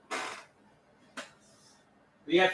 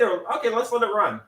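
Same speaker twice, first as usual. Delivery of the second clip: usual speaking voice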